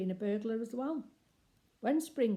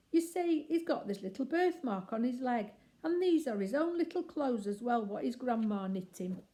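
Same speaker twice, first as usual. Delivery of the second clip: higher, chatty cockney lady burglar; a lady burglar delighted to recognise Bill; Burglar Betty; 6 semitones higher, 5 dB louder than usual. Acted. Betty voiced by narrator.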